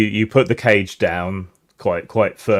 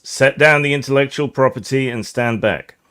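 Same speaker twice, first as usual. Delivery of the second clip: higher-pitched firm command; acted guard, voice raised in pitch; corporate guard's order; 6 semitones higher, 4 dB louder than usual. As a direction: order them back as an armed guard. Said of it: "the guy in front says".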